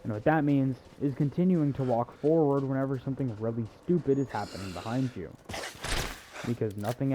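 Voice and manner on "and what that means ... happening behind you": ordinary delivery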